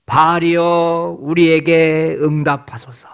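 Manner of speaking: long drawn-out, chant-like plea to a god; prophets calling Baal. Acted. Baal's prophets beg their god to answer, drawn-out calling. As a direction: call out slowly, stretching each word in supplication. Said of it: "바알의 이름을 부릅니다".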